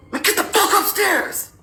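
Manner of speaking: high, strained mock yell at no real volume; imitating an angry husband's yell; teeth-gritted yell. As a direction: grit your teeth and order them away. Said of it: "Yelled, and like how you grit your teeth".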